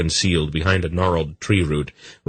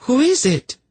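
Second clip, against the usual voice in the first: much higher, loud, fearful; frightened demand; 3 dB louder than usual. A narrator's reading. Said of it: "demanded, her voice shrill with fright".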